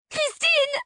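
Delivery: very high, loud shriek of a name; a child screaming at her mother; high-pitched yell. A narrator's reading. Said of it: "se mit à hurler d'une voix aiguë". (hurler d'une voix aiguë)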